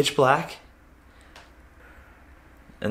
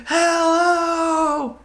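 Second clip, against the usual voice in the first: long, high-pitched desperate holler; drawn-out retold scream, far above usual pitch; screamed hello. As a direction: scream it out into empty darkness. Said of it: "I look over there and I scream".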